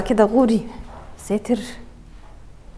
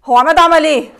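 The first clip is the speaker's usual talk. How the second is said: loud, startled disbelief; shocked outburst; 3 semitones higher, 8 dB louder than usual. Acted. Stepmother shocked to find the girl alive, loud. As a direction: shout in shock.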